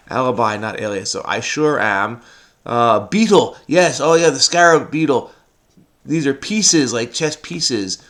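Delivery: ordinary delivery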